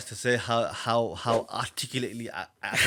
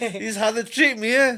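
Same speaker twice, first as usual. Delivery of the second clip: sarcastic, much higher and louder; mock outrage; 11 semitones higher, 9 dB louder than usual. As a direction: fake indignation, playing it up for a laugh. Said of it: "I know you're joking".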